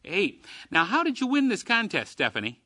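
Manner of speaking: usual speaking voice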